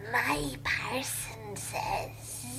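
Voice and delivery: low, slow hissing creature rasp; Gollum voice. Retold or imitated. Mocking the book by reading it as Gollum. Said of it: "is she Gollum now".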